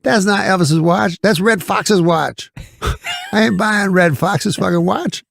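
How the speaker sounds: high-pitched comic outrage, imitated; mock-indignant comedian impression, punching the names; Eddie Murphy. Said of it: "So he's like, super funny".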